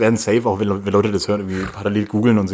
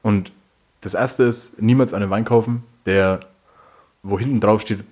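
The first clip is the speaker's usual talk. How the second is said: usual speaking voice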